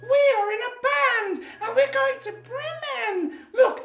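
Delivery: very high, excited cat; cat character. Acted. Cat recruits cockerel for the band, high excited voice. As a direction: squeal the invitation like an eager cat. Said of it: "And the cat said".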